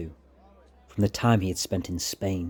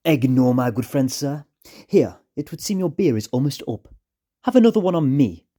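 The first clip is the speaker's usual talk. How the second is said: smooth, raised friendly con man; louder, higher smooth-talking rogue; sly charmer; 5 semitones higher, 5 dB louder than usual. Acted. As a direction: charm the drunk while steering him off. Fawkes voiced, charming the drunkard.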